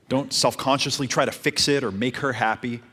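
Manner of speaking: usual speaking voice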